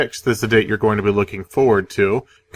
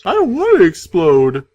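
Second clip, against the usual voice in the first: villain voice; 8 semitones higher, 6 dB louder than usual. Retold or imitated. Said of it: "And then Shoban was all".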